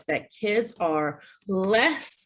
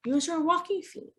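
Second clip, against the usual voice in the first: bright higher sing-song instruction; upbeat positive reminder; 10 semitones higher, 4 dB quieter than usual. Shown as a demonstration. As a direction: coax the child kindly. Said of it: "you can say".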